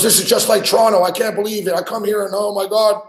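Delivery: high-pitched mock panic on the phone; retold panicky shaking. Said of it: "shaking and shit on the phone".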